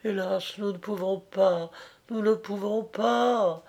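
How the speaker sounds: high, restrained cry of anguish. A narrator's reading. Bernerette cries out to her lover, anguished. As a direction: lament it high and softly, in anguish. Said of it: "s'écria-t-elle avec angoisse".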